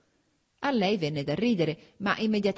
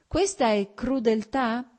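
slow, louder sneering question; slow mocking retort; scornful question; 6 semitones higher, 4 dB louder, slower than usual. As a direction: drag it out with contempt. Karenin mocks Anna's word.

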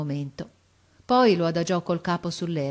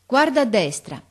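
loud, raised command; called-out order; 6 semitones higher, 7 dB louder than usual. A narrator's reading. Officer directs the lookout, calling out. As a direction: call the order up high.

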